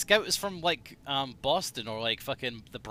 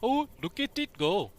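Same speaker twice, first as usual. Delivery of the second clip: Witch Doctor line; 8 semitones higher than usual. Retold or imitated. Quoting Dota voice line.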